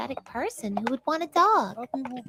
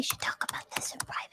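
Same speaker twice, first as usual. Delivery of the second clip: hushed secret whisper; 9 dB quieter than usual. Acted. Girl whispering to her dad about a family matter. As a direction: whisper it so nobody overhears. Pulling daddy aside privately.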